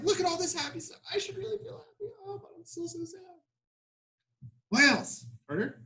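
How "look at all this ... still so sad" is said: higher, softer, hammed-up tearful whine; mock sad whine. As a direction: play the moping poet, mock crying. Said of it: "maybe he's like".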